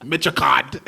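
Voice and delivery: Metro card in Brooklyn style; New York subway twang; Brooklyn accent. Shown as a demonstration. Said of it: "my Brooklyn accent comes out".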